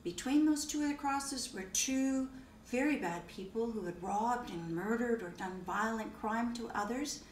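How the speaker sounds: ordinary talk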